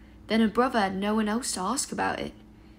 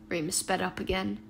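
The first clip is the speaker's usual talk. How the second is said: ordinary delivery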